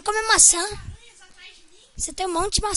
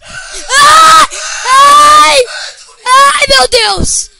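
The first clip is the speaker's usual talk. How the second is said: loud, high frightened screams, slow at the end; startled scream; 7 semitones higher, 13 dB louder, much slower than usual. Real, not acted. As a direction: scream in real fright at a sudden scare. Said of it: "Não grita!". (gritos de susto, altos e agudos)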